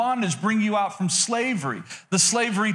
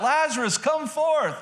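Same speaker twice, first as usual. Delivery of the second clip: raised, commanding call pitched higher; Jesus calling Lazarus out of the tomb, commanding; 7 semitones higher than usual. Retold or imitated. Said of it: "he yells out".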